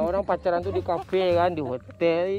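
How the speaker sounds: loud mocking scolding. Real, not acted. Prankster mocks couple for dating on the cheap, calling out. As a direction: chide them loudly, stretching the words.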